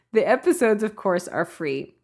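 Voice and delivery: normal talking voice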